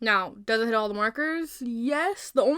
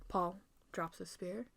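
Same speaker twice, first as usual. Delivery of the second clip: ordinary talk, nothing marked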